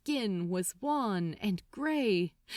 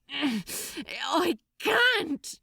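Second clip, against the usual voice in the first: despairing cry; 5 semitones higher than usual. Acted. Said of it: "he cried, filled with such despair".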